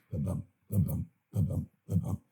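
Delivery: "the bump" with the weight on "bump", like a heartbeat; heartbeat rhythm. Shown as a demonstration. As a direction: beat out "the bump, the bump" evenly. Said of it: "based on the heartbeat".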